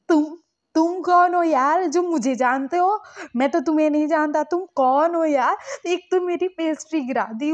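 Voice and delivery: high, loud scolding quarrel; angry. Retold or imitated. Lanson scolding the stranger who grabbed him, loud. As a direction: tell him off loudly, childishly.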